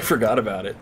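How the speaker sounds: usual speaking voice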